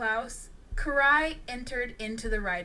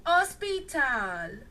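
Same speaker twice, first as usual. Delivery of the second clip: usual speaking voice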